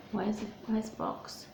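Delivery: usual speaking voice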